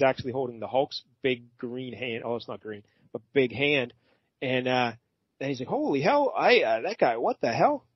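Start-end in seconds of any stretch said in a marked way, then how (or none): 5.7-7.9 mock startled, pitch swooping up and down; played-up bewilderment; startled reaction. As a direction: act out a baffled double take. Acts out Angel's startled reaction.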